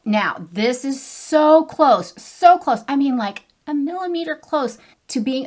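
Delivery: so close, rising to a squeal; thrilled it almost matches, pitch climbing up high; excited. Real, not acted. Excited over near-perfect dupe.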